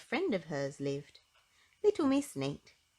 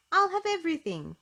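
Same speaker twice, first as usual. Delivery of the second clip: high, loud greedy order; a greedy diner grinning as he orders; 11 semitones higher, 7 dB louder than usual. Acted. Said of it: "he grinned".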